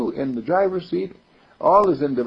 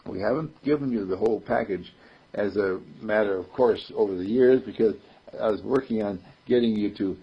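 normal talking voice

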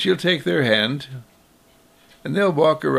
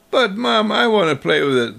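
put-on higher, louder, fast kid's whine; protesting child; 6 semitones higher, 3 dB louder than usual. Child protesting he wants to play with his truck, whiny. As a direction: plead your case to mom.

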